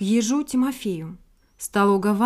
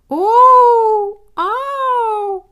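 high, loud wailing call; plaintive moaning call; 11 semitones higher, 11 dB louder than usual. A narrator's reading. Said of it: "жалобные стоны".

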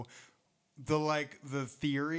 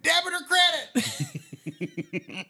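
high, loud, drawn-out shout; debit-or-credit call; 10 dB louder, much slower than usual. Retold or imitated. Re-enacting the prank on dad's neck rolls, high gleeful shout. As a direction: holler it like a gleeful prank announcement. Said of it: "and I went".

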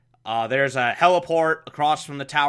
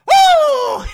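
put-on high squealing baby-talk shriek; Aunt Harriet impression; 11 dB louder than usual. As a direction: shriek like a fussy old aunt. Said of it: "replace all of her dialogue with".

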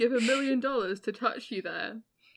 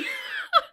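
a sudden high squeal of laughter; 4 dB louder than usual. Real, not acted. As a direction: burst into a squeaky giggle. Cracking up mid-reading.

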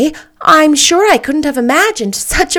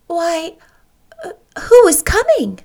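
tender to a bird; 8 semitones higher than usual. Acted. Anne singing praise to bird.

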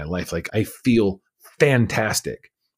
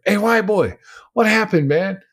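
much higher, eager hail; calling out; 11 semitones higher, 3 dB louder than usual. Retold or imitated. Prisoner hails him five minutes after his return, calling out. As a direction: pitch it way up to get his attention.

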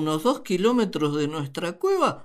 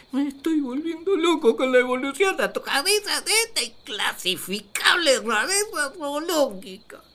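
exasperated despair; 6 semitones higher than usual. Acted. Adam exasperated by the creature.

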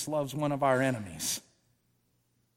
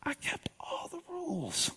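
whispered, crying complaint; hurt whine; 11 semitones higher, 12 dB quieter than usual. Retold or imitated. Older brother complaining to his father, whispered and tearful. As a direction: plead your case through tears.

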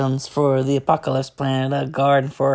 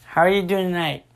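normal talking voice

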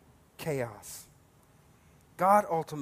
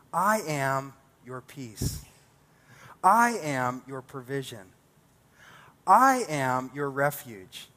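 building sermon cry; 3 semitones higher, 6 dB louder than usual. Acted. Pastor proclaiming God's names to the congregation, building to loud. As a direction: climb each "I am" like a preacher rising to a peak. Preaching at full force, building.

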